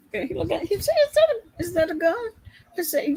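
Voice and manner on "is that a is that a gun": imitating a startled guy, pitch jumping high. Dentist spotting her gun, mock startled high squeak.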